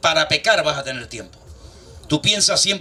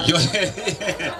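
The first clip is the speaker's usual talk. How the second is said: slow, laughing through the words; 3 semitones higher, much slower than usual. Real, not acted. Pastor retelling his reply to the sister, laughing. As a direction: chuckle as you say it.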